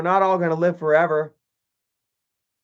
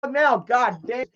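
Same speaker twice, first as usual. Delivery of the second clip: higher, exasperated give-up rant; fed-up dying dad; 8 semitones higher than usual. Pretend. Vlogger pretending to be the dad on his deathbed, fed up. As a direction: done with it all, just get me out. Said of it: "I'd be like".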